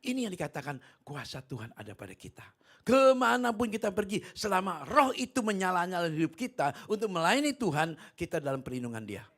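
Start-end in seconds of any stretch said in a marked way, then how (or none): 2.8-9.2 loud, high proclamation easing at the end; full-force preaching. Real, not acted. Preacher promises God's protection to believers, forceful preaching. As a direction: drive the promise home to the congregation.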